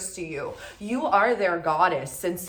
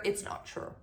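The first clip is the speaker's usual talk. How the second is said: whispered aside; 11 dB quieter than usual. Real, not acted. Aside refuting their persona.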